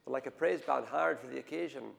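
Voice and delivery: usual speaking voice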